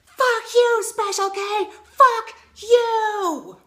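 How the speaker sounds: yelled high and loud at the TV; furious shout. Acted. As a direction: scream it at the screen, full rage. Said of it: "it looks something like this".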